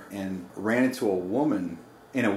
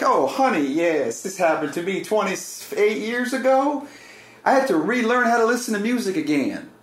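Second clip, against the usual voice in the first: much higher, lightly voiced sweet old lady; cooing old church woman; 10 semitones higher than usual. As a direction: coo it like a kindly old woman in a wheelchair. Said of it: "she had, like, an accent".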